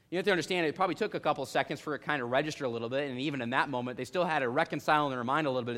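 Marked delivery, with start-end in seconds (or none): none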